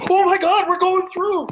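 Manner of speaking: high, loud mock alarm; faked panic. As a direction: pretend the ice is breaking. Imagined prank, faked panic.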